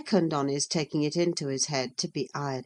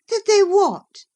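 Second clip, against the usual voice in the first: high, loud sharp question; crying out; 10 dB louder than usual. Acted. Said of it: "cried Catherine".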